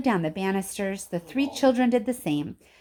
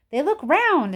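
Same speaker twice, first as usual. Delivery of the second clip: insistent; 8 semitones higher, 5 dB louder than usual. A narrator's reading. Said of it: "insisted Mister Wonka".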